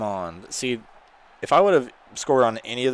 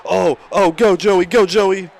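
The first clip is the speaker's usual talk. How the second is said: loud, high cheering for a player; excited cheering; 10 semitones higher, 12 dB louder, slower than usual. Real, not acted. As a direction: yell him on down the field.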